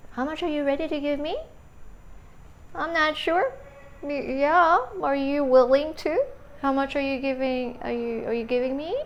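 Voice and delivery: high-pitched, uncertain questioning, every phrase lifting at the end; unconvincing, unsure. Shown as a demonstration. Teacher demonstrates a seller who doesn't sound convincing, unsure rising voice. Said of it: "that doesn't sound convincing".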